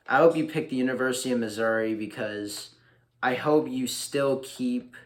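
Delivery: ordinary talk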